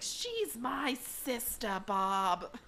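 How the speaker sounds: slow, drawn-out imitation of a cartoon character's accent; Gail's accent. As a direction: stretch the words out in a mock character accent. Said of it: "I love her accent so much".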